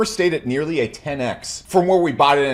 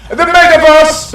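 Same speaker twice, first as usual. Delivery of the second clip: slow, loud, higher mock German "the metaverse"; German accent; 10 semitones higher, 13 dB louder, slower than usual. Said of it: "It must be said with a German accent".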